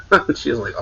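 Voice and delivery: usual speaking voice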